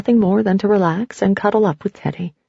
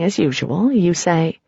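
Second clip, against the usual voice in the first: plain reading voice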